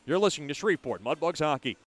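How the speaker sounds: ordinary talk, nothing marked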